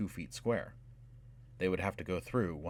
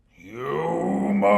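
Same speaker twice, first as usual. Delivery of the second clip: huge bellowing dragon voice, much higher and louder; dragon roar; 7 semitones higher, 9 dB louder than usual. A narrator's reading. Dragon discovering intruders in its cavern, roaring. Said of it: "A roar shook the cavern".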